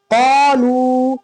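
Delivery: "क़ालू" slow, with "क़ा" held long; long vowel. Shown as a demonstration. Teacher showing the alif stretch, long vowel. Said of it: "क़ा को खींचेंगे".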